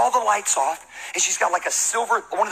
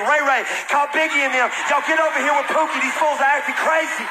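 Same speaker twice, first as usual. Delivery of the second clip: Kiki calling backup; 8 semitones higher than usual. Retold or imitated. Kiki on the phone calling her cousins, mock furious. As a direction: call in the whole crew. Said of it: "She's like".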